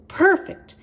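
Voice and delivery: high, loud joyful exclamation; happy owl cry; delighted cry. A narrator's reading. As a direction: owl crying out with joy. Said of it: "cried Little Owl".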